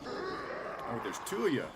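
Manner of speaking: sudden high-pitched alarm; startled, voice jumping up; surprised. Real, not acted. Second enemy suddenly appears.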